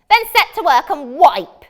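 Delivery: high, loud sneering wizard; mocking wizard. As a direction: taunt her like a gloating wizard. Wizard orders princess.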